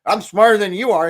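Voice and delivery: loud mock boast down at someone; mock gloating. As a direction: crow it like a smug know-it-all. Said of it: "looking down them saying".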